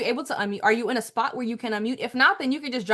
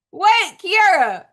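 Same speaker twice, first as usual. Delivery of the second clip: calling out; 6 semitones higher, 9 dB louder, much slower than usual. Real, not acted. Host calling on a viewer.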